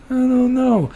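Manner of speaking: high, dumb shrug of a voice; clueless guy voice. Retold or imitated. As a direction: play the falcon owner clueless and lightly silly. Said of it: "And the guy goes".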